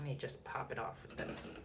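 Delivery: strained, breathy effort; whispered while prying a lid; opening a stuck jar. Real, not acted. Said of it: "it's really hard to get off".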